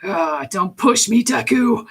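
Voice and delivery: a hint of a rumbling, restrained Bakugo; Bakugo's rumbling voice. Shown as a demonstration. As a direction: hold the anger down, slow and simmering. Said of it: "he talks like this".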